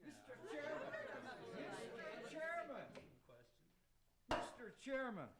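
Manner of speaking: calling for the floor. Acted.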